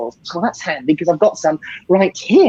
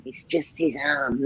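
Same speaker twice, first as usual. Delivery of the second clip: low, soft conspiratorial aside; a hushed aside to the audience; quiet aside; 5 semitones lower, 10 dB quieter, slower than usual. Pretend. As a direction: drop your voice to give the trick away. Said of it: "Don't ruin the illusion".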